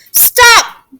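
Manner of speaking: high, loud command; piercing alarmed yell; sudden shout. Acted. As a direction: shout to halt someone urgently. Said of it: "Gertie shouted".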